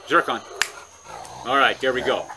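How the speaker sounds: usual speaking voice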